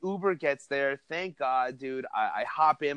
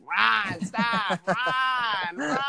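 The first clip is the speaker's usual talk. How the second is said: frantic yelling; 7 semitones higher, much slower than usual. Retold or imitated. Girl yelling warnings during fight.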